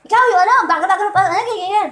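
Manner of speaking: loud, fast, high angry outburst; a retold furious driver, hammed up; mock road rage. An angry driver yelling at Brother Eddie over his new car, mock loud rant.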